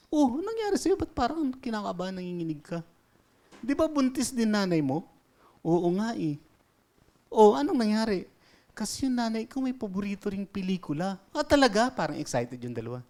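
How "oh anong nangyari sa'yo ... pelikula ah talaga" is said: put-on pitched-up kid dialogue; high child voices. Pastor voicing kids asking a worried friend, high childlike voice. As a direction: talk like curious children, high.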